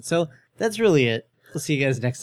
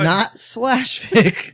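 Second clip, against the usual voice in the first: slow emphatic; 7 semitones higher, 3 dB louder, much slower than usual. Real, not acted.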